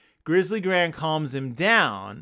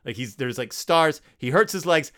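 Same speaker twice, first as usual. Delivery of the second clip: usual speaking voice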